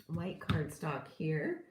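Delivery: ordinary delivery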